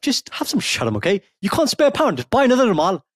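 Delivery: high-pitched mock telling-off; hammed-up indignant nagging; mock scolding. Mock scolding someone over a pound.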